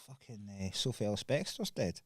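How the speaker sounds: hushed, deadpan retold news; dead serious. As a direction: break the bad news softly, imitating total seriousness. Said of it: "it was dead serious".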